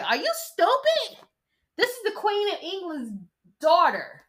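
a put-on voice of security berating the kidnapper; mocking security guard. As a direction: play the fed-up guard talking down to a fool. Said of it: "security is probably trailing behind these fools like".

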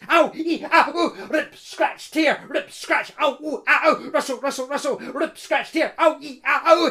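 high, loud yelping mixed with tearing noises; a string of high, loud ows and rips; comic yelps of pain. Acted. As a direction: cry out as thorns snag you. The woman caught in the brambles.

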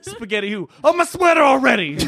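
high mock-rapper punchline; hammed-up rapper telling knock-knock jokes. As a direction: deliver a bad joke high, on the edge of laughter. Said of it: "That's his jokes are terrible".

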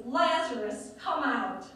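Lazarus called out loudly, commanding. A narrator's reading. Jesus calls Lazarus out of the tomb, loud commanding call. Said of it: "called out in a loud voice".

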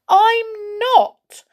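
high, loud, near tearful protest; upset insistence. Acted. Goldilocks tearfully protesting to Moonface she is not silly. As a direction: insist through tears you're not being silly. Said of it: "said Goldilocks".

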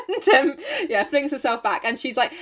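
ordinary delivery

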